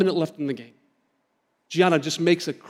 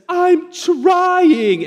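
high, loud imitation of an upset seven-year-old; mock a child protesting through tears; tearful child's protest; 11 semitones higher, 5 dB louder than usual. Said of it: "and tears just come down".

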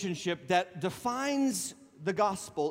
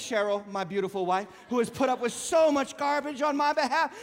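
normal talking voice

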